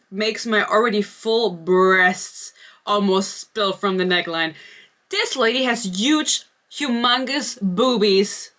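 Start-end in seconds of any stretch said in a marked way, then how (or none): 5.1-8.5 slow exaggerated emphasis. Real, not acted. Gushing over the heroine's looks.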